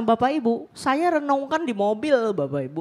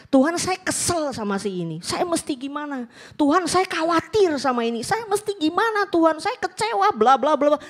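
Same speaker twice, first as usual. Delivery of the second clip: pleading to God, climbing much higher with each worry; mock pleading prayer; 8 semitones higher than usual. Preacher voicing a worried believer praying to God, climbing higher. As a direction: let the complaints pile up, pitch rising to a cry.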